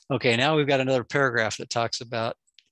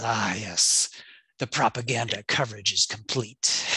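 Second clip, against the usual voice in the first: sinister Machiavellian voice; slower than usual. Retold or imitated. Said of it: "this sinister Machiavellian, uh, voice says".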